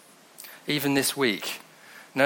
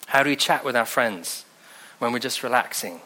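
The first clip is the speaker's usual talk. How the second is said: ordinary delivery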